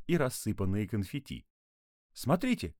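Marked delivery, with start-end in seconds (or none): 2.1-2.8 put-on high-pitched girl's call; crying out. A narrator's reading. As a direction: call out high to point something out. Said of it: "крикнула Алиса".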